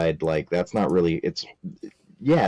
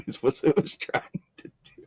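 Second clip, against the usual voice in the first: breathy laugh through the words, higher; 10 semitones higher, 3 dB quieter than usual. Real, not acted. Host laughing at her own joke, half-whispered giggle. Laughing aside after praise.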